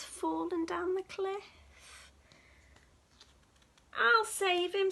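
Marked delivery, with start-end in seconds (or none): 3.9-4.9 squeaky. Acted. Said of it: "squeaked the squirrel".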